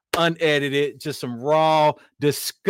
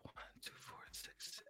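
whispered counting; 32 dB quieter than usual. Real, not acted. Counting platforms under breath.

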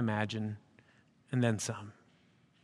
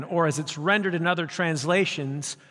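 normal talking voice

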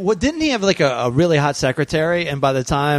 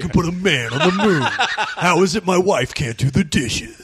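movie trailer voice. Retold or imitated. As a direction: announce it like a blockbuster trailer. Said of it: "as good as you just did that".

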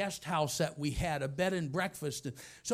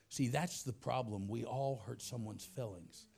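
ordinary talk, nothing marked

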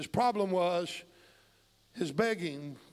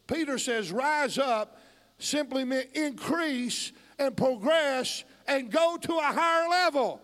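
high, louder preacher's build, stretched key words; emphatic preaching; 7 semitones higher, 6 dB louder, slower than usual. Real, not acted. Preacher explaining Peter's command to the church, building fervently. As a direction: punch each phrase, climbing toward a climax.

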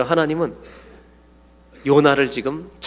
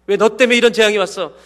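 accusing shout; 7 semitones higher, 7 dB louder than usual. Retold or imitated. Sailors accuse Jonah.

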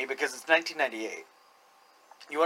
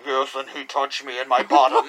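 imitated distorted voice; 4 semitones lower than usual. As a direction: lower mock disguised voice. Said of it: "We have distorted this woman's voice".

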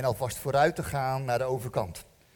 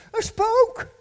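high, loud shriek of alarm; panicked shout; frightened cry; 8 dB louder than usual. A narrator's reading. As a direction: cry it out in sudden panic, far higher and louder. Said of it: "Ze riepen".

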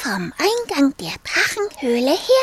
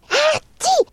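higher, louder mock sneeze; 13 semitones higher, 5 dB louder than usual. The dragon sneezes a flame, mock sneeze. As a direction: act a sudden sneeze.

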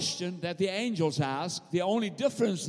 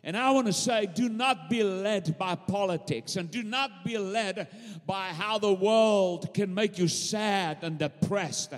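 ordinary talk

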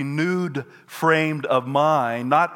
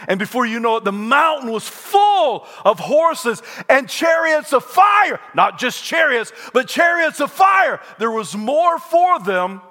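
loud, much higher cry on chariots of fire; shouted preaching climax; 9 semitones higher, 5 dB louder than usual. Acted. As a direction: lift the crowd to the peak.

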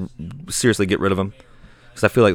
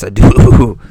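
loud eager yes; 13 dB louder than usual. Acted. Shaman eager reply.

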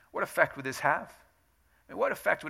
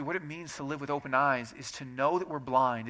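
ordinary talk

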